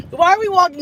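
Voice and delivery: high, baffled why-are-we-doing-this; incredulous. Retold or imitated. To the gate staff, baffled at walking outside. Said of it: "And I was like".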